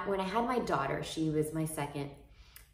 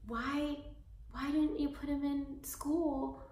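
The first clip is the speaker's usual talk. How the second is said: higher, gently persuasive voice; lightly voiced reassuring client; 7 semitones higher than usual. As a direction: coax the stressed mom kindly. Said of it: "she was like".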